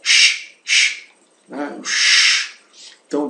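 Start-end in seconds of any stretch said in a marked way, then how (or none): 0.0-1.0 "Sh, sh" as two unvoiced hushes; hushing sh. Shown as a demonstration. Teacher likens SCH to silencing someone, hushing sh. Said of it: "como quando mandamos calar alguém". 1.8-2.6 "Sh" as a long unvoiced hush. Shown as a demonstration. Teacher showing how SCH sounds, hushing sh. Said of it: "como quando mandamos calar alguém".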